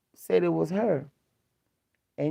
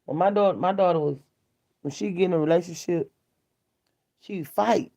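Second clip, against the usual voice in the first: usual speaking voice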